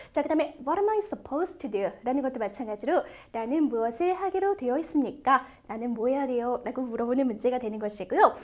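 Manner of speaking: ordinary delivery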